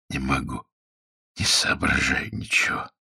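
very soft, spent, half-whispered; barely manage the words; weak hungover voice. Acted. Hungover prisoner tells interrogator he can't think, faintly.

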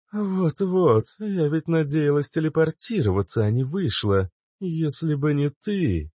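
lightly voiced Cobra. A narrator's reading. (Кобра благодарит)

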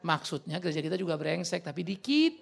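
normal talking voice

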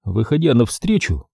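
plain reading voice

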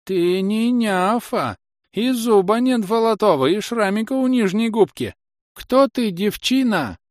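very high, loud crone voice; alarmed old woman. Acted. Old woman confronting the impostor, high alarmed cries. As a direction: cry it out high as an accusing old granny.

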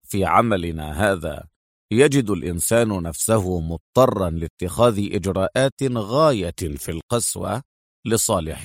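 low, mocking male character; understated, deep-voiced mockery; sarcastic. A narrator's reading. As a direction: open with a dry scoff, then lecture deeply. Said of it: "ابتسم أدهم وقال ساخرا".

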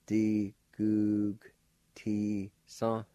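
"Di gug ti son" slowly, syllables held; measured "Di gug ti son" for learners to copy; slow pronunciation. Shown as a demonstration. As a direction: sound out "Di gug ti son" with K-like G and nasalized N. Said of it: "like this".

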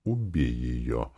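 low, soft, slow sinister command; voice in the head. A narrator's reading. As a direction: draw it out low and quiet, inside his head. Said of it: "услышал я голос в своей голове". (низкий, тихий, медленный голос в голове)

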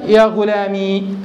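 يا غلامي drawn out slowly with a silent final ya; slow sukun demo. Shown as a demonstration. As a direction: lean slowly on غلامي and end on a long i. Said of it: "قولك يا غلامي".

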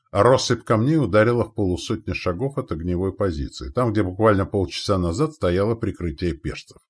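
usual speaking voice

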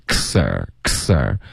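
"kser" as one slow cluster, k into s; k joined to s. Shown as a demonstration. Teacher drills the linked sound, k joined to s. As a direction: isolate "kser", sounding the k before the s. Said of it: "是一个k连到s的音".

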